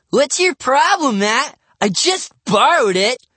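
high, loud protest; indignant kid pushing back; defensive. A narrator's reading. Defensive boy answering back.

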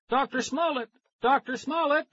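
very high shout across the lab; shouting a name. A narrator's reading. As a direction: shout for the doctor. Calling for the missing doctor.

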